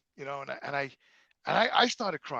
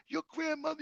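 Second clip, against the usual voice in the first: imitated mother; 10 semitones higher, 9 dB quieter than usual. Mother voiced, defensive.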